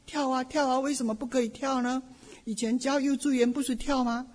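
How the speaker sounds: high, eager call to dance; excited urging. Retold or imitated. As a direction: cheer them on to dance, quick on the question.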